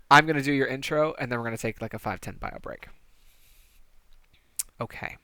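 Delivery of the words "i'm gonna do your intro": laughing. Real, not acted. GM laughs at bad roll.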